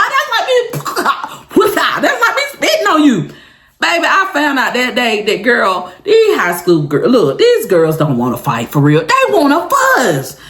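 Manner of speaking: normal talking voice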